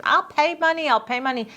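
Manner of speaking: high-pitched retold plea, easing down; imitated pleading woman. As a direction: mimic someone eagerly offering a way out. Imitates woman offering to pay.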